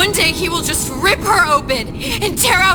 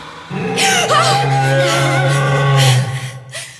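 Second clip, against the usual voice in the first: sharp gasp; 11 semitones lower than usual. Acted. Sudden fright.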